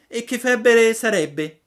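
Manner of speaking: high, loud startled puppet; Pinocchio alarmed. A narrator's reading. Pinocchio startled by the news, high and loud. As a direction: jump up in alarm.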